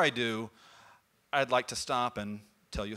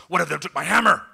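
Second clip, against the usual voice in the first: indignant complaint; 3 semitones higher, 8 dB louder than usual. Acted. Thor complaining to gods.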